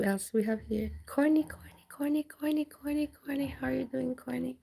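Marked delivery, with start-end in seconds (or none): 1.1-2.6 high sing-song whisper of a name; teasing high whisper; playful whisper. Pretend. Calling a viewer playfully. 2.6-4.6 cute greeting. Pretend. Greeting viewer cutely.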